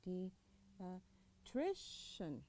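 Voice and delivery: Pediatrician said slowly and softly, rising on tri; stress on tri. Shown as a demonstration. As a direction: let the voice rise on tri in Pediatrician. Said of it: "بتلاقي صوتك يقول إيش؟".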